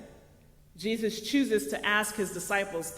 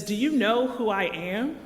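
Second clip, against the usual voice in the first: usual speaking voice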